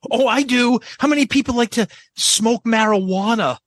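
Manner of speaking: hammed-up frontman asking about the crowd's vices; mock Paul. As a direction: parody the crowd banter. Mocking Paul's banter.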